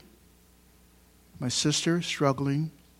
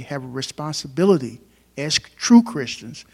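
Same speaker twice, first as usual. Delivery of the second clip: true Christians with true pressed hard and loud; louder, stressed true in a preached call; 8 dB louder than usual. Shown as a demonstration. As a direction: lean on the word true. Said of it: "emphasis on the word true".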